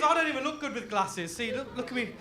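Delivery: ordinary talk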